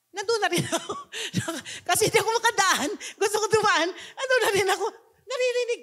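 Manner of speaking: high, laughing through the words; chuckling retelling. Real, not acted. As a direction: giggle as you tell it. Laughing while retelling mall scene.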